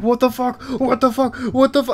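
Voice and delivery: high loud mock panic; panicked soldier. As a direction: voice the doomed soldier's alarm. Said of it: "He's like".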